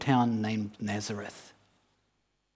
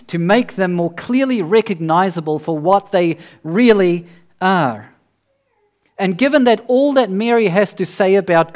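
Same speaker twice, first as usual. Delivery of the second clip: loud, raised preaching voice; impassioned preaching; 6 semitones higher, 9 dB louder than usual. Real, not acted. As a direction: proclaim it at full force to the congregation. Preacher rises to full force.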